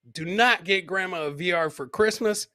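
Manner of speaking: ordinary talk, nothing marked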